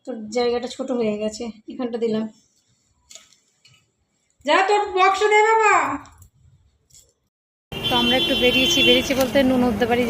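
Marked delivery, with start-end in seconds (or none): 4.4-5.8 loud, high call to her son across the house. Real, not acted. Calling son for his box again.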